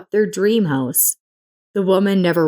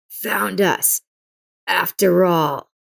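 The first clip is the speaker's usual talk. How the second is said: hissed; slower than usual. A narrator's reading. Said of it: "Caleb hissed".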